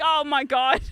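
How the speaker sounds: loud, tearful exclamation; crying oh my God. Retold or imitated. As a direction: cry it out, dismayed. Wife in retold story.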